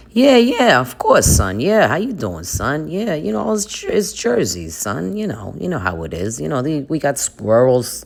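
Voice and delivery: put-on swooping New Jersey Italian accent; Italian Jersey dad. Dad answering his son's call, Jersey Italian accent. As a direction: play a chatty Jersey dad, pitch jumping about. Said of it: "'cause they're Italian".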